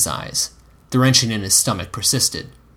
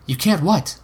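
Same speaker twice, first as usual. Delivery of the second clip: higher-pitched, pressing question; urging; 7 semitones higher than usual. A narrator's reading. Woman prodding her hesitant lover, urging. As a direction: press for an answer, pitch lifted. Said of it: "she urged".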